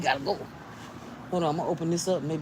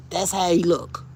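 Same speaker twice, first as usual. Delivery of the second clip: loud alarm; 4 semitones higher, 9 dB louder than usual. Real, not acted. Alarmed at the close-up bug.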